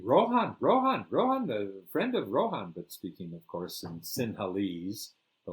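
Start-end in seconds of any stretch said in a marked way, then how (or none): 0.0-2.7 high, loud calls of a background crowd; shrill-pitched mock chorus, easing at the end; excited echoing crowd. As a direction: imitate relatives passing a name along. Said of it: "eight people in the background echoing".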